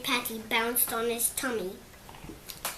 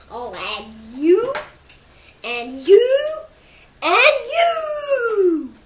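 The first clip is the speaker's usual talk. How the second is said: each you louder and higher, ending in a cry; building threat; 8 semitones higher, 10 dB louder, slower than usual. A narrator's reading. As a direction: grows into a hungry roar, one victim at a time. T. Rex threatens the diners.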